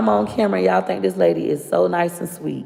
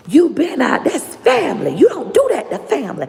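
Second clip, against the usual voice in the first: high-pitched scolding grandma voice, much higher; imitating grandma Johnny Mae; 12 semitones higher than usual. Granddaughter mimics grandma forbidding the call, high scolding voice. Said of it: "my grandma Johnny Mae said".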